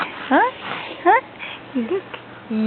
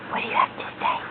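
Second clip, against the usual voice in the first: whisper; 11 dB quieter, much faster than usual. Real, not acted. Parent whispering to baby on camera.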